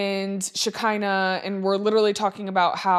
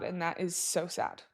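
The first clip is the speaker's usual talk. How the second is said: quietly sad; 10 dB quieter than usual. Real, not acted. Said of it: "I say that out loud".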